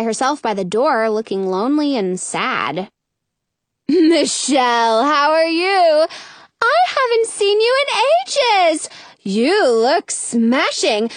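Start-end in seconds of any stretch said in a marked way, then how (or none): 3.9-11.1 gushing fairy. Acted. Fairy greeting friends.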